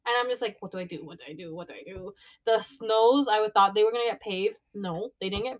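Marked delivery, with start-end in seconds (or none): 0.6-2.1 low, hushed self-talk; retold worried muttering under the breath; anxious muttering. Pacing the room unsure about work, anxious muttering.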